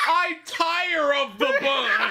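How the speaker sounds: high, slow, laughing proclamation; mock-regal weariness. Co-host as a customer sick of buns, mock-regal complaint. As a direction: declaim it like a hammed-up bored noble. Mock old-time drama about bun.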